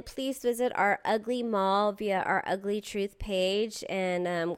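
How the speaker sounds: ordinary talk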